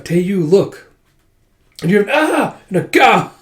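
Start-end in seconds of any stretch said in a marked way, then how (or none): 2.1-2.6 high sharp yelp; pained cry. Acted. Soldier struck down. 2.8-3.3 high, loud pained yelp; pained grunt-cry. Acted. Soldier struck down.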